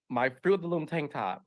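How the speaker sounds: ordinary delivery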